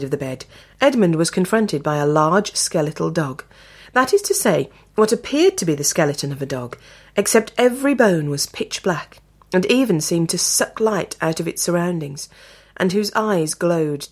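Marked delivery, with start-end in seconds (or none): none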